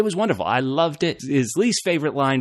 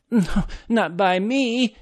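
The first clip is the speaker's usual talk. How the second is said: much higher, very slow mocking retort; a higher, stretched-out send-up of the line; mock weak snapback; 9 semitones higher, much slower than usual. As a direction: parody the comeback as a limp snap. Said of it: "Snap, not so strong".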